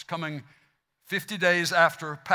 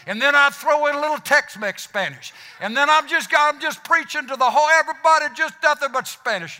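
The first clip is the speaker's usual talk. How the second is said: loud, raised, fired-up preaching; pitched up and loud with excitement; 5 semitones higher, 4 dB louder than usual. Pretend. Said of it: "I'm fired up just by the tone of my voice".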